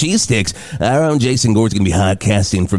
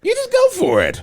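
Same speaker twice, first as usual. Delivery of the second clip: very high falsetto; falsetto mimicry. Radio host parroting the mayor's slogan, falsetto. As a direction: squeak it out in a mock falsetto.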